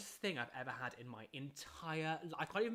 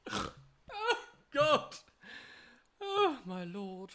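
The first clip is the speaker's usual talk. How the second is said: laughing; 10 semitones higher, 7 dB louder, much slower than usual. Real, not acted. Laughing at own joke.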